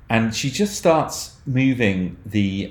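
normal talking voice

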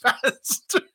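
slow, high, laughing through it; laughing echo. Real, not acted. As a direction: repeat it while cracking up.

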